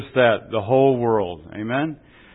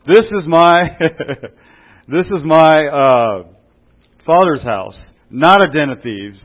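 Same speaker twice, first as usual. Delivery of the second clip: louder, a forceful claim; loud, half-acted Jesus clearing the temple; Jesus proclaiming; 3 semitones higher, 8 dB louder, slower than usual.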